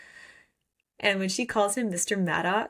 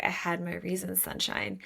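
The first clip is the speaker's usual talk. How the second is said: seductive whisper; 6 dB quieter than usual. Retold or imitated. Bastian to Shara, hushed whisper. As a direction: breathe it like a secret. Villain voiced in retelling.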